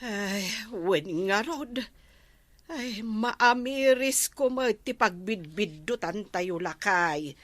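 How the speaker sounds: ordinary talk, nothing marked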